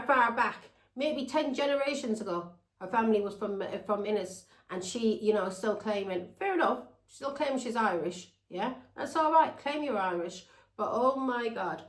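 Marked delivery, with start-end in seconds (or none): none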